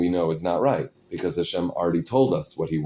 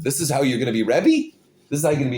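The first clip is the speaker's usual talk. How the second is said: imitating a brother's raised, incredulous rebuke; indignant scolding; 6 semitones higher, 3 dB louder, much faster than usual. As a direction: scold in disbelief, pitch jumping up. Brother scolds the new rebbe.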